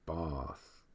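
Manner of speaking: bath with a long "ah", like bahth; long-vowel bath. Shown as a demonstration. Comparing how words are said, softly saying bath as bahth. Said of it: "if it's what's the bathroom".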